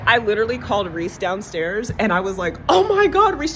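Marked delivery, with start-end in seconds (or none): 2.7-3.5 high, loud squeal of amazement; amazed exclamation. Retold or imitated. Calling her niece downstairs to see the yard, amazed shriek. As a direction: shriek it out in delight.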